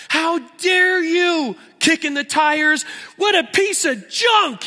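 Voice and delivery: high, shouted fury at a dead car; hammed-up tantrum at the car; yelling at the tires; mock car rage. Car owner raging at broken car.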